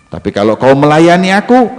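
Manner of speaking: high, loud, rising offer; forceful role-play proposition; acted ultimatum. Woman bargains with a threat.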